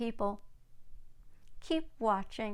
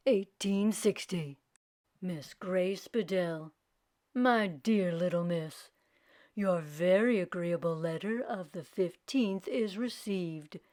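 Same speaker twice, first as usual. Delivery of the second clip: lower, measured reading of a formal letter; lower, dignified Lincoln letter voice; 4 semitones lower than usual. Acted. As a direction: read it like a statesman's letter. Reads Lincoln's letter aloud.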